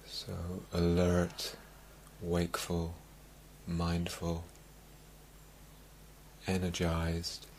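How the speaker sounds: calm meditation-teacher voice with long, spacious pauses; guided meditation. Acted. Teacher easing meditators into calm, guided meditation. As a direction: settle them gently, letting each phrase sink in.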